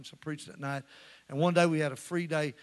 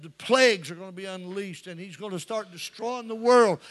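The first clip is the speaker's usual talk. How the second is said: loud, raised-pitch doom sermon; fiery preaching; 7 semitones higher, 12 dB louder than usual. Retold or imitated. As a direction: build steadily as you pile up the judgments. Preaches the end times at full force.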